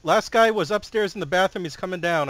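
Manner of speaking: loud urgent callout, voice up high; yelling callout. Real, not acted. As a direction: shout it over game chat. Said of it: "stop yelling at this dude".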